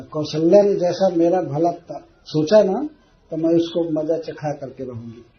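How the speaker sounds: ordinary talk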